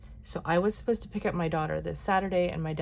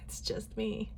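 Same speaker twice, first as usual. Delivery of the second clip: whispered; 4 semitones higher, 6 dB quieter than usual. Real, not acted. No one else can help.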